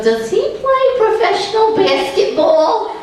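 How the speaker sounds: put-on high, loud and drawn-out old lady squeal. Curious old lady in the produce section, high and loud. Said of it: "she goes".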